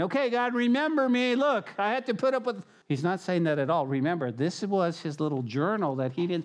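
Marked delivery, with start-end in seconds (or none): 0.0-2.6 high, whiny mock boast; mock complaint to God, high and whiny; mock bragging Nehemiah. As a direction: play a self-pitying braggart, pitched up. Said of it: "He's not going".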